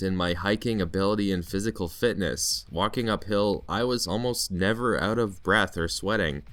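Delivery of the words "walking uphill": ordinary talk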